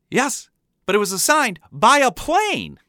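high-pitched, insistent protest; exasperated kid. A narrator's reading. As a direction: argue back like an outraged child. Kid argues back at dad.

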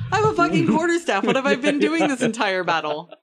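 high, loud, baffled indignation; indignant outburst. Acted. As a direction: burst out in exasperated disbelief. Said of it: "exactly how Peleus would respond in Thomas's body".

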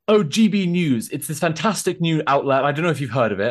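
plummy upper-class English put on for the reporter; mock the smug posh newsman; posh reporter accent. Protester mimics the posh GB News reporter, plummy accent. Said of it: "that accent".

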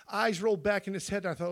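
usual speaking voice

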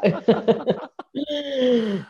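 quick laughing admission. Real, not acted. Laughing about own experience.

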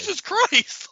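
very high, squeaky acted shock; hammed-up shock, pitch way up; startled yelp. Man just missed by a taser, high mock-startled cry. [name] reacts to being shot at.

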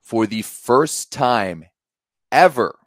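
slow, rising to a loud ever; excited build-up. Real, not acted. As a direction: drag it out, then punch ever. Hyping the tournament.

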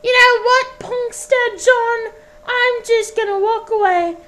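put-on high, huffy old woman; Scottish woman voice. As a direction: flounce off in a high huff. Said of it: "various different accents".

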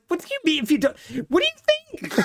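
hammed-up disbelief; incredulous mob boss. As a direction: play Leo baffled anyone would ask. Voicing Leo's outraged disbelief.

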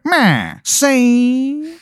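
high, drawn-out spooky call; a hidden voice calling out; mysterious voice from the dark; eerie call. Retold or imitated. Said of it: "From behind you hear".

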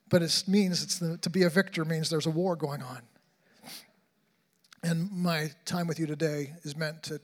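ordinary talk

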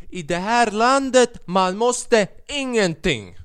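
high, sing-song mock Italian intonation, drawn out slowly; emphatic Italian accent. Italian cashier lecturing customer about the receipt, emphatic accented Swedish. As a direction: declare it with hammed-up Italian emphasis. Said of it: "han sa det med sån betoning".

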